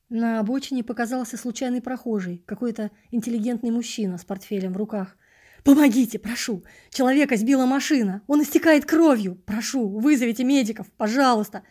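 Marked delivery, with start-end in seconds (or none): 5.6-11.6 loud, higher urgent begging; pleading shouts. Acted. Narrator loudly begs the passerby to call medics. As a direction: beg a stranger to call for help.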